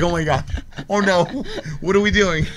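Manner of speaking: high, loud panicked gasp of alarm; mock panic. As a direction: play it as sudden comic dread. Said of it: "I'm like".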